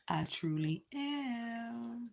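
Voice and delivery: slow soft whisper. Real, not acted. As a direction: whisper it slowly. Quiet aside after signing off.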